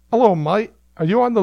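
a doorman's Aussie-Cockney twang; imitating an Australian accent. Teller voicing the club doorman, mock Australian accent. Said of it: "Suddenly he's Australian".

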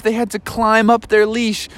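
ordinary delivery

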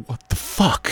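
baffled reaction. Acted. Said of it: "You're like".

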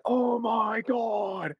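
screaming hype caster, very high and slow; Joe Rogan caster. Retold or imitated. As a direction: stretch it out like a frantic esports commentator. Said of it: "the Joe Rogan cast".

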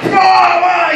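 grumpy giant bellowing whoa; neighbor yelling. Retold or imitated. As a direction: shout whoa at kids creeping close. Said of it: "And there's, like, eight of us".

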